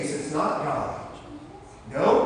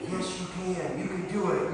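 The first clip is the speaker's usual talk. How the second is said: softer, gentle assurance; soft encouragement; 8 dB quieter than usual. Acted. Pastor as God softly reassuring the children. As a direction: say it softly like a whisper of comfort. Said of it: "God whispers to us".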